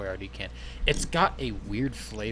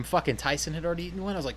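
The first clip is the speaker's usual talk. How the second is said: ordinary talk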